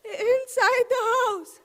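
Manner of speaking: slow tearful words; crying. Acted. Shilpa sobbing to the inspector about the house. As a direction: choke it out slowly through tears. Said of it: "She started crying again".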